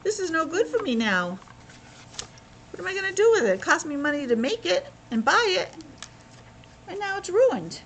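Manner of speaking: high whining foreman voice; upset foreman. Retold or imitated. Foreman laments his ruined cement, high and whiny. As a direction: moan it like your cement is ruined. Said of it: "He's saying".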